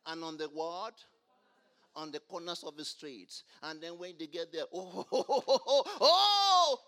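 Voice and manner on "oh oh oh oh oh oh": mocking laugh. Mocking hypocrites' showy street prayer.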